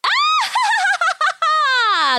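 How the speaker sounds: very high, descending cackle; long run of high ha-ha-has; gleeful witch cackle. Acted. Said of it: "laughed gleefully".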